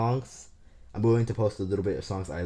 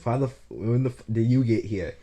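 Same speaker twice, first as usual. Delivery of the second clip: shocked; 3 semitones higher, 5 dB louder than usual. Retold or imitated. Shocked nurse sees him arrive.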